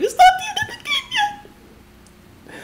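extremely high squealed wail; hysterical squeal. Real, not acted. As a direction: squeal in despair, half laughing. Realises game continues.

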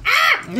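high, loud squeal of delight then laughing; real delighted squeal and laugh; delighted shriek. Real, not acted. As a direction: squeal at the glittery card. Glitter card opened.

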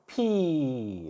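"피" with the ee held long and falling; one long, sliding pee on "피"; long vowel demo. Shown as a demonstration. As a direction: stretch "피" out as a model for learners. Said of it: "이렇게 됐어요".

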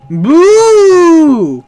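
high, loud playful boo; startling boo. Pretend. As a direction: jump out and shout boo. Jumps out to scare.

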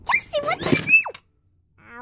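high, slow mock yell; playful angry mom shout; pretend mom yell. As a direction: scold Chelsea as the mad mom. Mom doll yelling.

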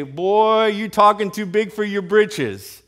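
loud, high mock swagger; cocky taunt. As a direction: talk down to an upstart. Mock boast David never made.

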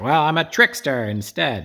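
mock-proud trickster line; cheeky claim. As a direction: play it up like a sly hero. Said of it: "she's also like".

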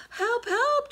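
high, loud panicked cry for help; frightened trumpet. Acted. Frightened elephant crying for help to Mama Frog. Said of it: "trumpeted Elephant".

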